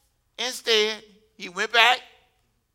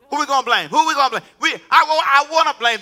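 rising preacher shout; 5 semitones higher, 7 dB louder than usual. Real, not acted. Preacher building up.

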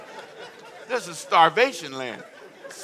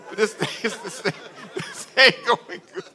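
laughing; 3 semitones higher than usual. Retold or imitated. Retold prayer, laughing.

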